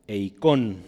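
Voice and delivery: eikon with the accent on the O; eikon, stressed on "kon"; stress on final O. Shown as a demonstration. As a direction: say eikon, leaning on the last syllable. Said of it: "con acento en la O".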